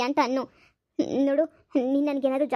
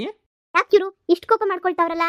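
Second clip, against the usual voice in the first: indignant shouting; 4 semitones higher, 5 dB louder than usual. Acted. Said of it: "ಯಾಕ್ ಇಷ್ಟೊಂದ್ ಕೂಗಾಡ್ತಾ ಇದ್ಯಾ".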